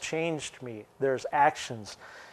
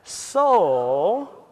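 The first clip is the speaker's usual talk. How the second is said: "So" lifted high and held; inflected so; 3 semitones higher, 3 dB louder than usual. Shown as a demonstration. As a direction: sing out "So" as an ellipsis. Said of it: "so everyone say that".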